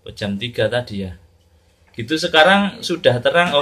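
ordinary talk, nothing marked

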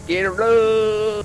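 high, slow, loud-ish holler; drawn-out catchphrase. Pretend. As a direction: stretch out the last word playfully. Echoes teammate's catchphrase.

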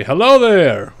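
loud, high hello across the garden; hearty shout of hello; friendly call. Acted. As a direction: call out a cheery greeting from a distance. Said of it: "wave my hand and say".

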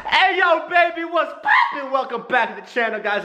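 high, shouted hype YouTuber greeting; hype intro. Shown as a demonstration. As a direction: go way over the top with hype. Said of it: "I'll show you how it's done".

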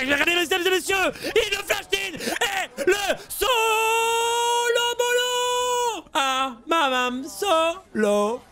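very high scream with long-drawn words; ecstatic shoutcast. Real, not acted. Caster announcing a solo kill to the audience, screaming ecstatic. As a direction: stretch each word out in a huge goal-style cry.

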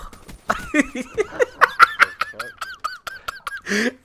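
very high squealing laughter. Real, not acted. Laughing at a friend's impression, high squealing laugh. Cracking up at imitation.

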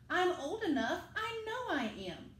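put-on a small boy insisting he's old enough; little Sam insisting.

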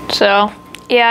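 usual speaking voice

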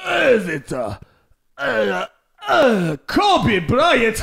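put-on grunting, drawn-out Russian accent; mock straining Russian. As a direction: strain out each word as a Russian caricature. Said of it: "tryna squeeze a turd out".

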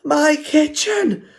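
high, loud, slow shriek. A narrator's reading. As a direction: Mum shrieks at her ruined kitchen. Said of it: "shrieked Mum".

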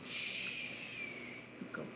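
soft soothing hush; calm the dog down gently; hushing a pet. Real, not acted. Parent hushing the excited dog.

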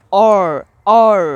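অর with the r fully sounded; American "or" sound. Shown as a demonstration. Teacher isolates the American ending for learners, অর with sounded r. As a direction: draw out অর and let the r ring. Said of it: "এই সাউন্ডটা আসে".

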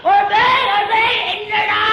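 high, loud exclamations in bursts; hammed-up yelling; comic outburst. Acted comic outburst, calling out repeatedly.

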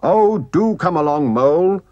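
brisk, cheerful calling voice; cheerful reply. A narrator's reading. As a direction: cheerily hurry a friend along. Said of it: "replied the rat cheerfully".

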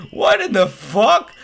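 loud, high, drawn-out disbelief; shocked outburst. Real, not acted. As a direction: burst out, stunned. Startled reaction mid-reading.

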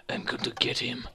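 sinister whispered villain voice; Nathan. Acted. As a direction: whisper it like a plotting creep. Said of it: "he must have been like".

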